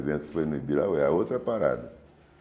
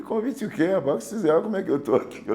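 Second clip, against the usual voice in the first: indignant retort. Retold or imitated. Indignant at doormen asking invite.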